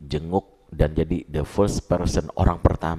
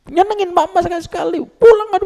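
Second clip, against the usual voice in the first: put-on much higher, louder shrill-free nagging scold of a child; mother scolding; 8 dB louder than usual. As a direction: nag the child like an exasperated mum. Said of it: "ya ngomel lah saya". (ibu mengomel keras dengan nada sangat tinggi)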